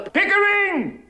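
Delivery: high, drawn-out cry of the name; exasperated call. Acted. Exasperated cry to interrupting colleague.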